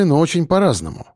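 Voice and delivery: ordinary delivery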